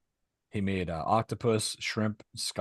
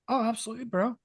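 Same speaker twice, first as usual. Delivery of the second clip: eager mock reply; 12 semitones higher than usual. Cheerful exchange, voiced high.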